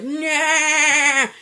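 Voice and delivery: high, loud gleeful "Nah!"; gleeful yell. Retold or imitated. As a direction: yell it with glee. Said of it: "He's like".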